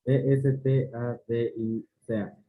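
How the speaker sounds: letter by letter, evenly spaced; spelling it out. Shown as a demonstration. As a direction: spell each letter out separately.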